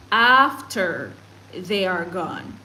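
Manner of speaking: ordinary talk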